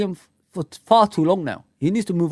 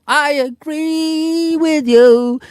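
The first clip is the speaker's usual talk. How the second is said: slow, loud and higher, stretched out; hyped build-up; 3 semitones higher, 6 dB louder, much slower than usual. Real, not acted. Streamer hyping a viewer before the soul glow jingle, slow and loud. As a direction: wind up the crowd for the song.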